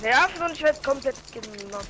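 high, loud panicked yell; stressed shout. Real, not acted. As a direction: shout it under pressure. Being knocked down in fight.

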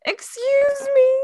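high, slow, offended excuse me; indignant excuse me. Real, not acted. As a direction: act scandalized by the fee. Outraged at dilation fee.

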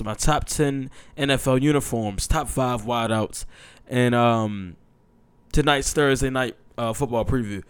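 ordinary talk

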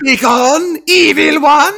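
high, loud hammed-up banishing cry; Rik Mayall villain line. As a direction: cast out the evil one, over the top. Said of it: "the most Rick Mail it got".

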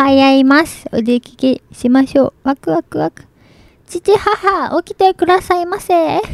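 a hint of a cute anime child; Anya-style anime voice. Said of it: "やってみますか。".

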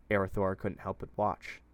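plain reading voice

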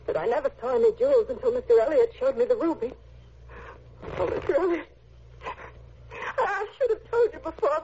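usual speaking voice

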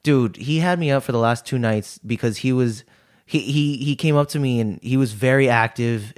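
ordinary delivery

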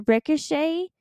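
normal talking voice

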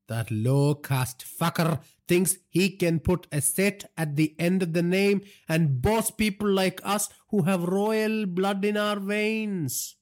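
high, slow, indignant father's rant; temper. A narrator's reading. Father rants to son about Ranja's presumption, in a temper. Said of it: "laughed at his father's temper".

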